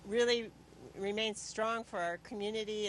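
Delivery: ordinary talk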